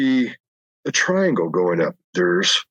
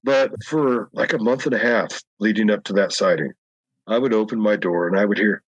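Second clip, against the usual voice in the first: ordinary talk, nothing marked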